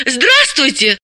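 high, eager hello; excited woman's exclamation; exclaimed greeting. A narrator's reading. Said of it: "воскликнула Далила".

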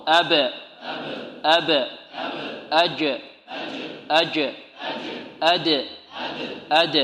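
أق، أط، أب، أج، أد each with a short bouncing release; isolated syllables, echoing the final consonant, pausing after each; qalqalah drill. Shown as a demonstration. Teacher leading repeat-after-me, أق، أط، أب، أج، أد with a crisp bounce. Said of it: "أقرأها وتردون خلفي هكذا".

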